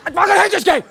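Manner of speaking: high, exploding exasperation; acted rage-quit; frustrated outburst. Comic plays a player fed up with Bop It, high angry outburst. Player losing temper at toy.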